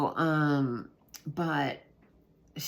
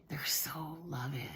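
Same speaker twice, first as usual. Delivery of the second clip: whispered; 9 dB quieter than usual. Real, not acted. Tender aside about the kids.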